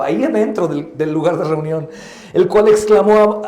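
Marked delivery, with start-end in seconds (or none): none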